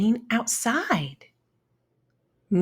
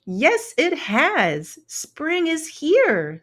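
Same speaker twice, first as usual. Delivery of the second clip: higher, slow happy announcement; smiling; 9 semitones higher, slower than usual. Acted. Orange-shelled ladybug telling Lou spring is here, smiling. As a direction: beam as you share the good news. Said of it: "smiled the orange-shelled ladybug".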